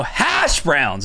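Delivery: "hash browns" with HASH overdone and stretched; exaggerated stress on HASH. Shown as a demonstration. Teasing the co-host who corrected, exaggerated stress on HASH in "hash browns". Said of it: "Is that how you do it?".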